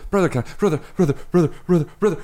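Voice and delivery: high, pestering whine of a little sister; a kid sister wearing her brother down, whining; whiny nagging sister. Retold or imitated. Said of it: "most persistent obnoxiously persistent person".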